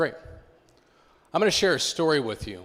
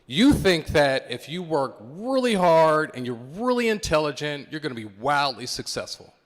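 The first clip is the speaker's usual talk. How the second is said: stern mentor; 4 semitones higher, 6 dB louder than usual. Retold or imitated. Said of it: "He said".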